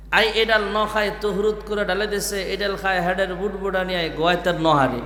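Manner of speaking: softly imitating Noakhali dialect; a light Noakhali village accent. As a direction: mimic a Noakhali speaker. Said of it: "এটা নোয়াখালীলা ভাষা".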